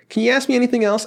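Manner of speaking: high-pitched exasperated plea; fed up. Retold or imitated. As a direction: plead like you're sick of the question. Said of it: "I'm so fed up with them".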